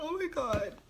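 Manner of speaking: mock despair, voice cracking up high; overacted heartbroken moan; mock wailing. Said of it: "It's not like I'm sitting like".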